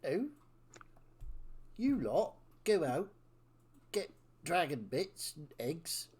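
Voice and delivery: menacing West Country villain; slow theatrical villain menace. Acted. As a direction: lay out the scheme with slow menace. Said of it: "doing a villain voice in West Country".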